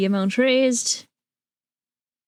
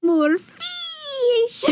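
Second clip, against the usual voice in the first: excited. Real, not acted. Excited call for more fishing.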